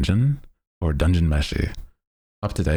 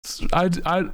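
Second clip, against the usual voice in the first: shocked viewer voice. Retold or imitated. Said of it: "I was like".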